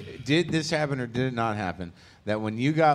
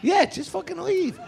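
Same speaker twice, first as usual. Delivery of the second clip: mock high whine. Mock quote, telling someone off.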